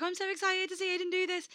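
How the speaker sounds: high-pitched imitation of excitement; excited fan quote. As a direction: gush in a very high borrowed voice. Quotes excited friend before show.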